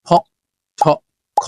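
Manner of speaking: ফ, ঠ, খ each said with a strong puff of breath; aspirated sounds. Shown as a demonstration. Teacher shows students the aspirated sounds, breathy puffs. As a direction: push air out hard on ফ, ঠ and খ. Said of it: "এই তিনটাকে বলা হয় aspirated sound".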